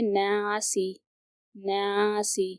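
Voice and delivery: नासी with a thin, light "na"; light nun. Shown as a demonstration. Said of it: "इसे हमें बारीक तरीके से पढ़ना है".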